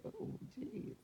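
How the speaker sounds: very high, soft squeak; tiny high-pitched "oh dear" of pretend apology; mock dismay. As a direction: play apologetic in a small high voice. Acting out a polite apology.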